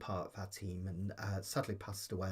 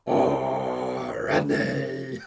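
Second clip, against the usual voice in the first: breathless mock French swoon; mock French accent; 3 semitones higher, 12 dB louder, much slower than usual. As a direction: do a comic sitcom French voice. Imitating sitcom character.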